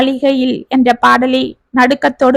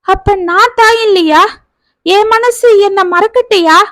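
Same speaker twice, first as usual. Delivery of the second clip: high, anguished cry; wailing again; 7 semitones higher than usual. A narrator's reading. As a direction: throw the question out in grief, voice high. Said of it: "மீண்டும் கதறினாள் சுமதி".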